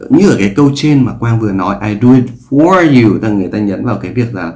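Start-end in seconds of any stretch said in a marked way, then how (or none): 1.9-3.1 "for" hit as the stressed word. Shown as a demonstration. Teacher showing the stressed form: "for" punched. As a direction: lean on "for", falling. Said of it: "nếu như người ta nhấn".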